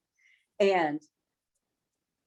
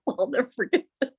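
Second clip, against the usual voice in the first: normal talking voice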